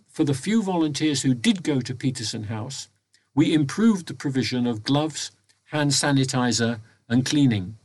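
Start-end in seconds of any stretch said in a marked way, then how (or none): none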